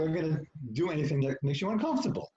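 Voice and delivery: high-pitched, insistent retold no; emphatic refusal. Couple refusing the reluctant wife's offer, emphatic refusal. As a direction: draw up the pitch in protest.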